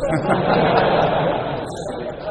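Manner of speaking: loud breathy laugh; laughing at the story's twist; burst of laughter. Real, not acted. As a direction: let out a hearty laugh. Laugh at Hudzaifah's answer.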